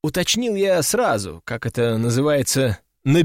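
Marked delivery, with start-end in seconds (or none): none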